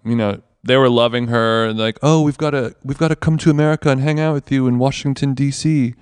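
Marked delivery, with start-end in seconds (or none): 2.0-5.9 posh British gushing; imitated British accent. British holidaymakers loving an American woman, posh British accent. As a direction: put on an eager upper-class British voice. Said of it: "talking to some British people".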